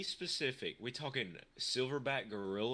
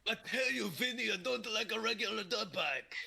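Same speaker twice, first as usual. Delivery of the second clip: put-on high, hammy Italian accent; mock Italian mobster accent; 8 semitones higher than usual. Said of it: "doing the Italian hands".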